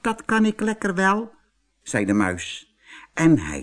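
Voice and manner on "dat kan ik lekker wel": very high, light mouse voice; little mouse. Acted. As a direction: squeak it out as a tiny mouse. Mouse answers the bull.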